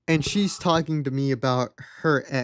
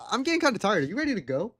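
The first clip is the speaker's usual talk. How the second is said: high, swooping polite excuse; put-on higher voice, angling to leave; feigned tiredness; 5 semitones higher, much faster than usual. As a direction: make a transparent excuse in a raised voice.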